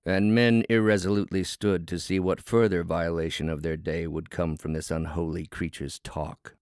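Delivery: usual speaking voice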